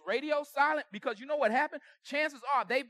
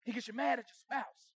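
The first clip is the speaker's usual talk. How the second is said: usual speaking voice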